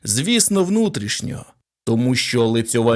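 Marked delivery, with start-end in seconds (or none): none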